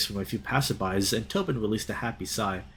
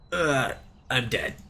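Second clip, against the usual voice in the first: mock dying; slower than usual. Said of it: "For my grand finale".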